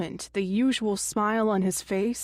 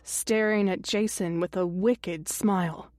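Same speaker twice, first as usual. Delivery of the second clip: ordinary delivery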